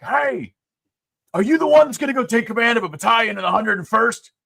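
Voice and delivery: high, loud general calling out; booming voice. Retold or imitated. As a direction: boom it down the hallway. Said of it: "this big booming voice".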